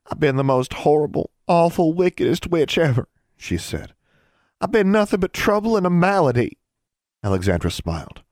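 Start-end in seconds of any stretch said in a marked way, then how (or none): 0.0-3.1 put-on high, overwrought young girl; tearful confession. A narrator's reading. Younger girl confessing to her rescuer, tearfully. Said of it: "her eyes filled with tears". 4.6-6.6 put-on high, overwrought young girl; tearful confession. A narrator's reading. Younger girl confessing to her rescuer, tearfully. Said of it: "her eyes filled with tears".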